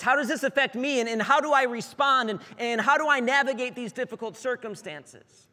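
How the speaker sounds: high, loud, flustered questions; agitated inner voice. Retold or imitated. Pastor acting out worried self-talk, high and loud. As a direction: rattle off the worries in a rush of alarm. Voicing anxious self-focused thoughts.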